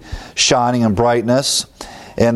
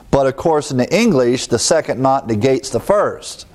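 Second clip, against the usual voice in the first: ordinary delivery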